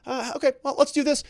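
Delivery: high-pitched, re-enacted courtroom aside; lawyer moving in. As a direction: play the lawyer pouncing on a forgotten answer. Acting lawyer reacting to forgotten answer.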